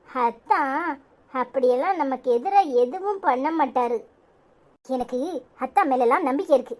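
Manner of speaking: low, steady voice of a sensible friend; calm older friend. Acted. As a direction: talk her down calmly, low and even. Second friend, lower voice.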